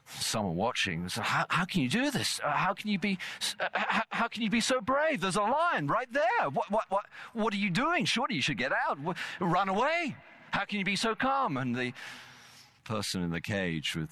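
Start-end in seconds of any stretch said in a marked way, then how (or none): 1.2-11.6 high, pressing, panicky questions; acted alarm, much higher voice; alarmed onlooker. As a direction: play the scared bystander begging him out. Frightened onlooker quoted in story.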